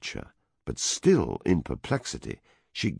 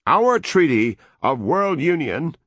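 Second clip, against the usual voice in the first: loud, higher, slow ultimatum; raised voice; 12 semitones higher, 7 dB louder than usual. A narrator's reading. American envoy issues an ultimatum, loud and high. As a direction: raise your voice to deliver the ultimatum. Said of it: "the American raised his voice".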